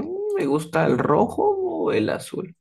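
high, musing tune with ROJO drawn up; open-ended choice. Acted. Student answering about favourite colours, undecided and musing. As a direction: ponder aloud, leaving the choice open. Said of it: "como que más onda para decir de que me puede gustar otros colores".

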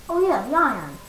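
normal talking voice

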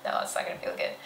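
breathy, unvoiced whisper; whispered aside. Shown as a demonstration. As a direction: drop to a strained whisper. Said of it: "We don't wanna go".

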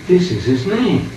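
ordinary talk, nothing marked

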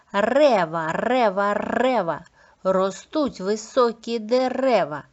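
strong trilled R in "Рева" and "дерева"; clear R articulation. Shown as a demonstration. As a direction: drill "Рева" three times, then "дерева" with a long roll. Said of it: "Чітко вимовляєте звук Р".

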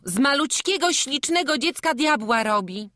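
loud, high indignation; angry outcry. A narrator's reading. Magda defends the little child from devil talk, angry outcry. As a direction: protest angrily at the top of the voice. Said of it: "zawołała Magda ze złością".

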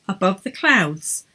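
plain reading voice

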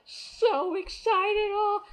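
high, loud, drawn-out gush; overexcited fan squeal. Retold or imitated. Fan as the singer walks by, squealing excitement. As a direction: stretch it out in singsong excitement.

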